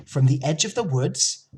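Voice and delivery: usual speaking voice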